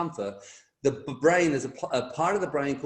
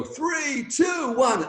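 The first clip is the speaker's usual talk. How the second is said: jump countdown; 11 semitones higher, 4 dB louder, much slower than usual. Retold or imitated.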